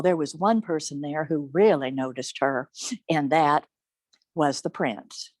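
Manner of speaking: usual speaking voice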